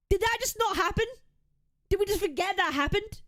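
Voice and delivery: high, exasperated disbelief; incredulous. Real, not acted. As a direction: cry out in stunned disbelief. Streamer reacting to the clip.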